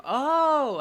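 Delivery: loud, high-pitched "oh"; excited outburst. Real, not acted. Reader reacting mid-game, excited squeal. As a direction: burst out, pitch leaping up.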